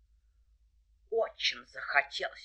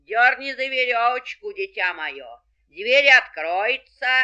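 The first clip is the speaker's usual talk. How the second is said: wolf in a slightly thinner voice; wolf faking granny, a bit higher; wolf in disguise; 3 semitones higher, 10 dB louder than usual. A narrator's reading. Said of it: "сказал потоньше".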